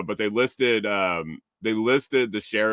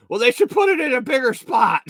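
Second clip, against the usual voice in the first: put-on high, louder grumbling old lady; huffy old lady; 13 semitones higher, 5 dB louder than usual. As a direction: complain like a put-out older woman. Old lady complains to clerk.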